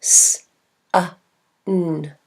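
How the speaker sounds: "S" hissed, "a" as uh, "n" hummed; bare sounds of sun, one at a time; separate phonemes. Shown as a demonstration. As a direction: sound out "S, a, n" with pauses. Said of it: "Here are the sounds in this word".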